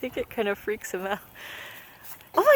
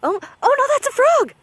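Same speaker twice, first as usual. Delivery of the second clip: high, loud frightened shriek at a frog; real fright, screaming high; startled scream; 13 semitones higher, 10 dB louder than usual. Real, not acted. Mom spots a frog by the dog and shrieks.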